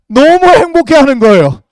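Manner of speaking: drawn out, high and loud delight; gleeful delight. Retold or imitated. Preacher on senior students thrilled by women arriving, gleeful. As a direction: stretch it out with loud, hammed-up joy.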